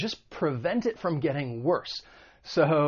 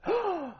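quick high indrawn breath; demonstrated gasp. Shown as a demonstration. Teacher quizzing learners on the verb, mock gasp. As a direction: take one sudden audible breath. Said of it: "What verb is that?".

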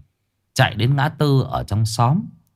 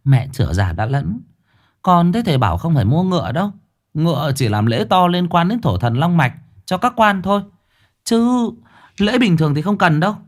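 a hint of a brisk daughter, quicker; lightly voiced daughter; 7 semitones higher than usual. Daughter correcting her mother, lightly voiced and quick.

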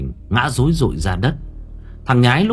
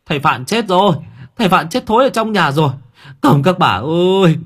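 higher, louder panicked shout, rising toward the end; 8 semitones higher, 4 dB louder than usual. A narrator's reading. Villager bellowing to the village that the master died. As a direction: bellow the news to the whole village. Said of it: "Người ta gào lên".

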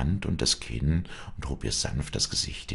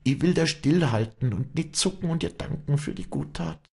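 very high, pleading woman's voice; anguished high entreaty; desperate Wally; 13 semitones higher, 4 dB louder than usual. Acted. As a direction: beg urgently, pitched far up. Wally begs to be shot.

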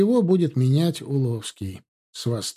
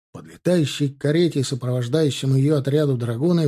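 usual speaking voice

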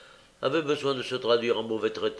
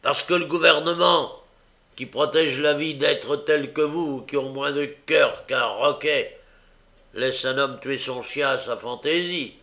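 angry; 4 semitones higher, 6 dB louder than usual. A narrator's reading.

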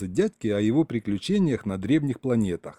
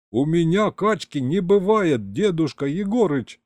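high-pitched, wounded protest; offended; 6 semitones higher than usual. A narrator's reading. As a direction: object as if insulted. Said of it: "обиделся Серёга".

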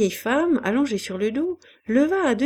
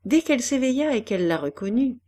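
ordinary delivery, nothing marked

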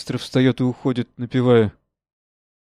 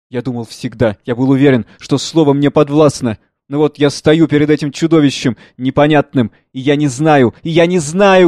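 put-on louder, higher, imploring old man; pleading father; 3 semitones higher, 8 dB louder than usual. Father begs son Pierre, loud imploring. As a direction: beg your son for the word.

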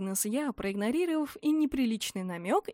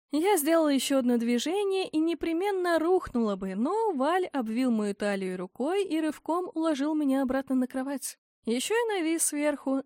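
ordinary delivery, nothing marked

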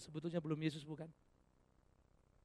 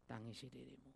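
hushed quote; 6 semitones lower, 14 dB quieter than usual. Acted. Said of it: "Ia katakan".

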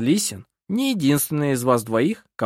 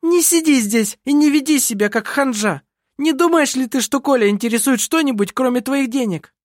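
high, louder, furious attack; enraged, scornful tirade; sudden fury; 12 semitones higher, 4 dB louder than usual. A narrator's reading. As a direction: round on her in a fit of rage. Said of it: "В припадке внезапной ярости".

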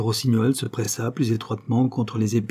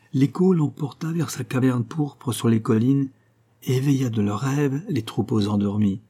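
plain reading voice

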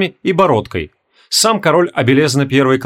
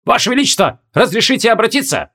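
barked request; 8 semitones higher than usual. A narrator's reading. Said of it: "гаркнули сзади".

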